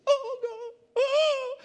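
very high, loud wailing cry; overcome cry to God. Real, not acted. Preacher overcome by how available it is, high wailing cry.

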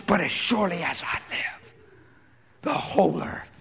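a lightly voiced God, solemn proclamation; hint of a booming divine voice; God proclaiming. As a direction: declare it as God speaking. Preacher voices God's promise.

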